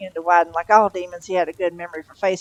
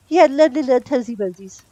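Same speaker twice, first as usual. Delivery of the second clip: put-on higher, louder cooing baby talk; wistful baby talk; 6 semitones higher, 7 dB louder than usual. A narrator's reading. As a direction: coo fondly about the baby. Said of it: "Mary Hodges wistfully".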